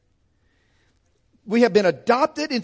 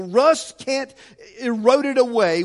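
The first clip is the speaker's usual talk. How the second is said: normal talking voice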